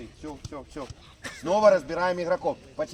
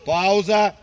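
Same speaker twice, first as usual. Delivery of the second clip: yelling across the room; 8 dB louder than usual. Real, not acted. Coach calls timeout.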